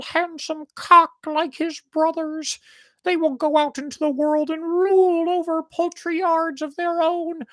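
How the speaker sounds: anxious mother hen lamenting her youngest; fretting mother hen. Acted. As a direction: cluck over her poor half chick. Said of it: "His mother shook her head anxiously, saying".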